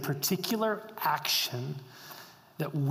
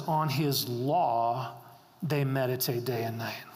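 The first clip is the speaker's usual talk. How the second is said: lower, unhurried recitation; scripture reading; 5 semitones lower than usual. Acted. Reads scripture verse.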